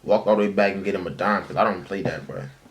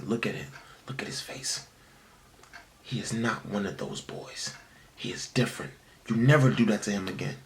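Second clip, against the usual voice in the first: hushed, unvoiced whisper of a protective mother; whispered mom; 9 dB quieter than usual. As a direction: whisper it fiercely, like mom defending her boy. Mom retold again, whispered.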